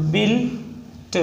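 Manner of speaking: Built drawn out in parts, "bil", pause, "t"; sounded out slowly. Shown as a demonstration. Teacher breaking down a shorthand example, sounded out slowly.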